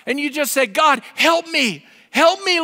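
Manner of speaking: normal talking voice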